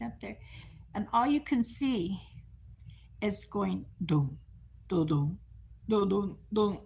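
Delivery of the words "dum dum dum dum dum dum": thump out her heavy steps like a drum; mock marching dums. Mimicking aunt's heavy march.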